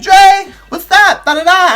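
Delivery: loud, high, drawn-out hail; called-out greeting. Retold or imitated. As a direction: call out the name like a long-awaited greeting. Officer greets detainee by calling out.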